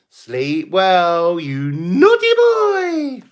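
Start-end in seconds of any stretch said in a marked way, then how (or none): 1.4-3.2 much higher, very slow, drawn-out squeal; teasing naughty boy. Pretend. Streamer teasing a viewer at goodnight, high drawn-out squeal. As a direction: stretch it out high like scolding a cheeky child.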